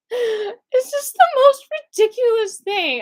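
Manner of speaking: high, slow words broken by laughter; crying with laughter at the absurdity; laughing. Real, not acted. Laughing at absurd jail phone.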